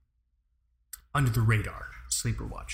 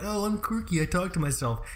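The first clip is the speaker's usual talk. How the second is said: mock quirky person; 6 semitones higher than usual. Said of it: "I know people are like".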